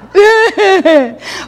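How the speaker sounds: high, bright burst of laughter; delighted laugh. Real, not acted. Laughing at the kids' game.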